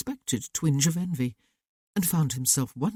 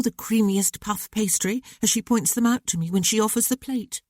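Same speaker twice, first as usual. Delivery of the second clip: higher, lightly voiced young woman, a little reproachful; young sister's voice; 6 semitones higher than usual. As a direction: voice the sister gently chiding her brother. Narrator voices sister Stephanie.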